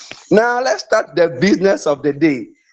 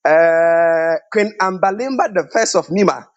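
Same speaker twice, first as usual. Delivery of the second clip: ordinary talk, nothing marked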